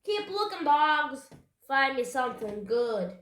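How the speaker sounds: loud, gruff orders to the crew; loud, scornful pirate bluster; blustering pirate captain. A narrator's reading. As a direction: bark orders at your men.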